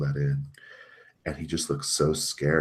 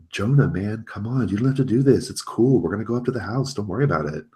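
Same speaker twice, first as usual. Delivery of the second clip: higher, louder wheedling appeal; pleading Tony; 6 semitones higher, 4 dB louder than usual. Acted. Tony pleading with Jonah to come on, coaxing and higher. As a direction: plead with a friend to let it go.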